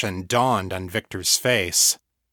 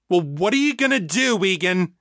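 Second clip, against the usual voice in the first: high, pushy demand; 9 semitones higher than usual. A narrator's reading. Victor demanding Egan's plan, high urgent push. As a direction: press him for an answer, higher. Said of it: "Victor demanded".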